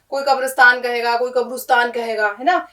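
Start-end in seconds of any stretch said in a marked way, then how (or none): 0.2-0.8 قبرستان as ka-bras-taan, with a after r; common mispronunciation. Retold or imitated. Teacher quoting people's mistakes, قبرستان as kabrastaan. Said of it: "لوگ اس کو غلط بولتے ہیں". 1.3-1.9 قبرستان as kab-rus-taan, with u after r; common mispronunciation. Retold or imitated. Teacher quoting another common mistake, قبرستان as kabrustaan. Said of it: "لوگ اس کو غلط بولتے ہیں".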